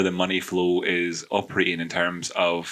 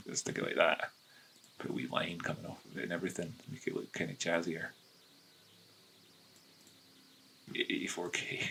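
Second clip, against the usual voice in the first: hushed, much softer murmur; whisper; 12 dB quieter than usual. Acted. Trader murmuring to himself over the chart, hushed whisper. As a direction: mutter quietly to yourself while you draw. Hushed commentary while drawing the line.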